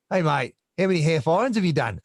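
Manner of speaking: high-pitched imitation Australian accent; Aussie accent. An Australian athlete chatting to a fellow racer, imitated Aussie accent. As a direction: put on a Canadian's take on an Aussie. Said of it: "with that accent".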